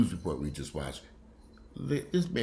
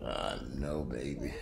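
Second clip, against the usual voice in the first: soothing dog; 6 semitones lower, 6 dB quieter, slower than usual. Real, not acted. Comforting sick dog.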